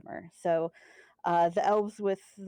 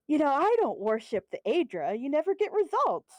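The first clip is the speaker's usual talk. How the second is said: preachy dark elf; 6 semitones higher, 4 dB louder than usual. Retold or imitated. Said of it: "she's like".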